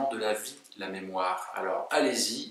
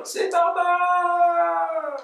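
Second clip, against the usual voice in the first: much higher, loud, slow drawn-out shout; class yelling; 8 dB louder, much slower than usual. Retold or imitated. Blonde pupils answer the teacher, high drawn-out shout. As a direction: yell the name as a whole class. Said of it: "toute la classe qui hurle".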